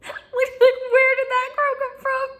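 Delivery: high, mildly startled friends; puzzled college friends reacting to the accent; a hint of startled friends. Friends from up north hear her Southern accent, lightly startled. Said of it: "They were like".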